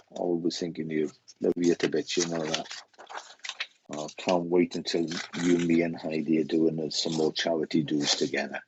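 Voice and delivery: usual speaking voice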